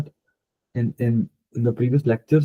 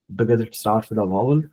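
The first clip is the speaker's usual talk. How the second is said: usual speaking voice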